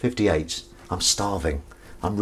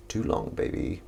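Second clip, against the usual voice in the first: low playful aside; 10 semitones lower, 12 dB quieter than usual. Pretend.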